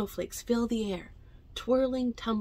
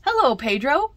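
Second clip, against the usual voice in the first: cheerful greeting; 6 semitones higher, 8 dB louder than usual. Acted. Children greeting together.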